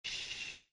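soft, hushed "shh"; whispered shush; small child's shush. A narrator's reading. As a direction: hush someone very softly. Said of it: "that of a small child".

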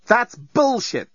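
snapping. Acted. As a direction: spit it out, annoyed and slow. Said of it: "snapped Lucy".

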